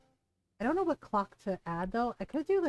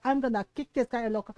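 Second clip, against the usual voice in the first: quick, louder mock-angry threat; imitating mad mom; 2 semitones higher, 4 dB louder, faster than usual. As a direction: rattle it off like an irate mother. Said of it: "She was so mad".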